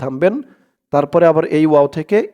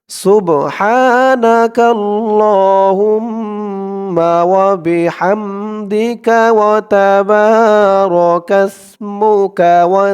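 slow recitation; 3 semitones higher, 4 dB louder, much slower than usual. Shown as a demonstration. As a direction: recite it stretched for learners to follow. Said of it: "আমি আবার বলে দিচ্ছি". (ধীর তিলাওয়াত)

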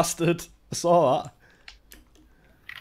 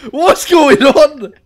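laughing disbelief; 10 semitones higher, 8 dB louder, much slower than usual. Real, not acted. Laughing at teammates' chaos.

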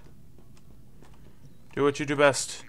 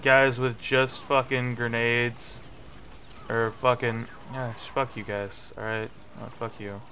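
ordinary talk, nothing marked

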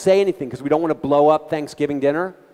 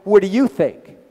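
loud challenge; 6 semitones higher, 12 dB louder, slower than usual. Retold or imitated. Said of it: "the terrible thing says".

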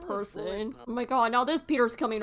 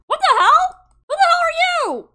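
even higher, louder outraged shriek; shocked yell; 10 dB louder than usual. Real, not acted. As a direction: shriek it in disbelief. Intruders in the house.

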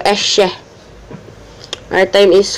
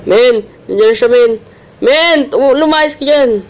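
high frantic yells; panicked shouting; 7 semitones higher, 4 dB louder than usual. Real, not acted. Panicked when Granny appears.

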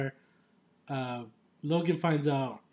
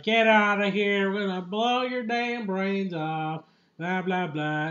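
loud, high hammed-up threat; 4 semitones higher, 4 dB louder than usual. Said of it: "those rednecks, those racist types".

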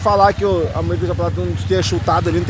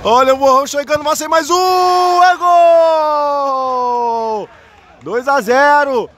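much higher, slow shouted goal cry; 6 semitones higher, much slower than usual. Real, not acted. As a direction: scream the goal out. (grito de gol)

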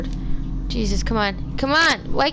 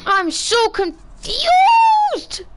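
exasperated; 8 semitones higher, 3 dB louder, much slower than usual. Real, not acted. Stuck in the game, exasperated.

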